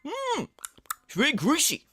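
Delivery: high mock pondering hum, then streamer voice; hammed-up pizza critic; Phil impression. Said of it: "some fucking person going".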